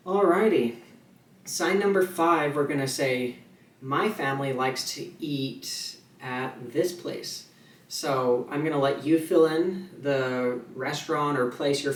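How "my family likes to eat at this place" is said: slow, measured My family likes to eat; slow example sentence. Shown as a demonstration. Teacher slowly reading the sample sentence to students. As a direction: read it out slowly for learners. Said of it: "I'm gonna say".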